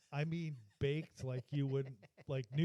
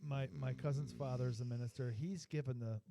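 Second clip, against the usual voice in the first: normal talking voice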